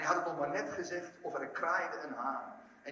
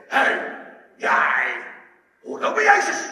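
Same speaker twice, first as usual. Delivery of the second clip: very loud, rough shouted accusation; soldier; 6 semitones lower, 13 dB louder, slower than usual. Retold or imitated. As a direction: imitate a soldier yelling a challenge. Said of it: "komt er een soldaat naar hem toe". (brullende soldaat, beschuldigend)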